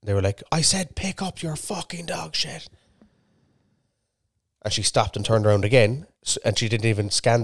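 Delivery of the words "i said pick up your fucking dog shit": imitated shout. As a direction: mimic someone yelling from afar, softly. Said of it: "someone shouted again".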